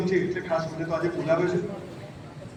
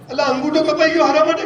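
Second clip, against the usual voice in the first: higher and louder, indignant disbelief; outraged question; 6 semitones higher, 5 dB louder than usual. Retold or imitated. Minister to the servant who calls it good, indignant shout. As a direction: fling the question back in disbelief.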